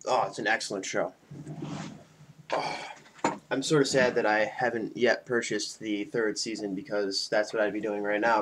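normal talking voice

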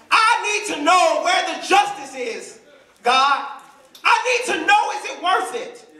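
high, insistent cry to God; pleading as the desperate John; anguished plea. Acted. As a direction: demand an answer, pitch leaping up.

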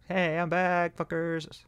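high put-on returning voice; comic entrance. Real, not acted. Player rejoining the table with a silly voice. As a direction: swagger back in.

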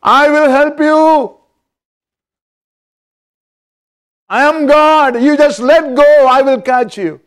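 high, loud, booming divine voice from above; voice of God. Retold or imitated. God answers the man hanging from the tree limb, booming and loud. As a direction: speak as God, calling down to him. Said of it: "he heard a voice".

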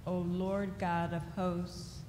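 normal talking voice

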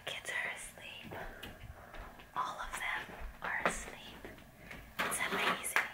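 breathless hushed relief; hushed whisper. Real, not acted. Mother to viewers with kids asleep next door, whispering.

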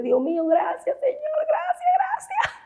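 reenacted tearful thanks climbing to a thin squeal; llanto agradecido imitado. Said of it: "yo me jinqué así llorando y dije". (llorando de agradecimiento, voz muy aguda)

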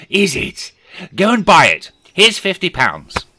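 high, loud eager order; excited command. Acted. Scrooge sending the boy to buy turkey, excited. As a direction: call it out with glee.

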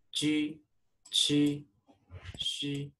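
ji, qi, xi said crisply and high; pinyin syllables demo. Shown as a demonstration. Teacher drilling ji qi xi for students. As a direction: model ji qi xi for the class. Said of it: "You can't pronounce u".

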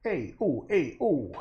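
lightly hammed Italian interjections; mock Italian. Painter echoes a recent comedy show, mock Italian. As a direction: toss out falling Italian-style calls. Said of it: "that Italian kind".

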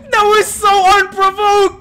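slow, high laughing shriek; laughing scream. Real, not acted. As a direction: stretch it out through laughter. Laughing at sudden kill.

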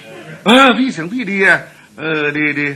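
wife's voice. Retold or imitated. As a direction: coo the praise like the pleased wife. Wife praises husband voice. (เมียชมเสียงผัวอย่างพอใจ)

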